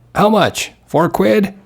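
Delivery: high, eager disbelief; mock surprise. Buck Mulligan hearing Stephen's pay, mock astonished. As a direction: pounce on the sum.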